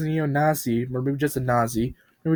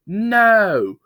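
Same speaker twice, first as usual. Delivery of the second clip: loud, high no; gleeful yell; 9 semitones higher, 7 dB louder than usual. Acted. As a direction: shout it gleefully. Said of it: "Bob yelled".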